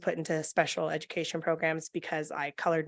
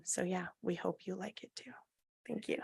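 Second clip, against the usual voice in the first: whispering; 13 dB quieter than usual. Real, not acted. Shy closing of public comment.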